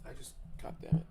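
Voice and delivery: whispered, beaten curse; defeated whisper. Real, not acted. Streamer whispering a curse after the fight, soft. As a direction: mutter it in defeat.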